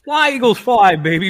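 high, loud fan cheer. Real, not acted. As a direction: cheer it for the team. Cheering the Eagles.

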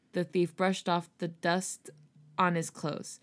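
usual speaking voice

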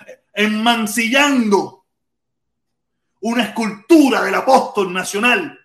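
ordinary delivery

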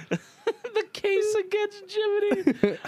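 drawn-out, laughing title read. Acted. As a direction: savor the title slowly through a laugh.

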